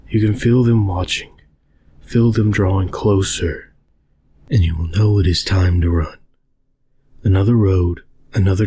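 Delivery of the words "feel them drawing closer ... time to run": ordinary delivery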